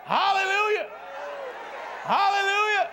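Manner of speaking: high, ringing holler; praise cry. Real, not acted. Preacher praising God who made a way, high exultant cry. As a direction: call it out high to the crowd.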